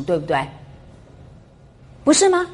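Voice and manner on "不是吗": incredulous challenge. Real, not acted. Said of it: "rhetorical challenge to class".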